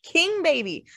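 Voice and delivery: high cry of the son's name; calling out. Acted. Father crying out to his grieving son. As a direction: call out to him in shock.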